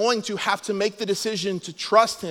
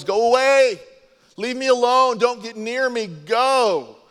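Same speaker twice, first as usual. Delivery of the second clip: higher, louder acted shooing away; mock rejection; 5 semitones higher, 4 dB louder, slower than usual. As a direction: imitate townsfolk driving lepers off. Voicing people who reject the lepers.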